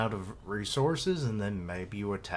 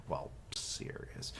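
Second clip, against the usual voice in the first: unvoiced whispered aside; 7 semitones lower, 14 dB quieter, slower than usual. Real, not acted. Commenting on the manga under his breath, whispered. Aside while reading the panel.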